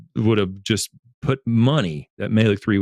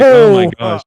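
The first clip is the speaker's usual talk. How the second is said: much higher and louder, astonished and half laughing; shocked exclamation; 12 semitones higher, 11 dB louder than usual. Retold or imitated. Retelling his reaction to the patrolman's joke, astonished and laughing. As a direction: blurt it out in amused disbelief.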